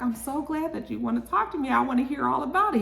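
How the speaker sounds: mock-sweet gushing reply; gushing woman impression. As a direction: put on an eager, sugary high voice. Voicing eager Miss Renee.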